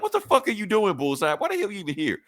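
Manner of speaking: high-pitched indignant demand; hammed-up irritated confrontation; exasperated boss. Acted Kingpin confronting Bullseye.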